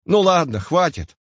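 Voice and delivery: a touch of annoyance, cutting in; irritated. A narrator's reading. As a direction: interrupt with mild irritation. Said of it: "несколько раздражённо прервал его Васечкин".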